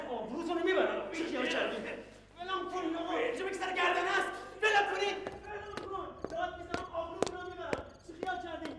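furious struggle with the guard; struggling shout. Acted. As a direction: yell while being dragged. Man struggles against guard.

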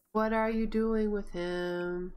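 slow, drawn-out questioning; slow repeat. Real, not acted. Player questions her Sim about the toddler, slowly. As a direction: stretch out each word deliberately.

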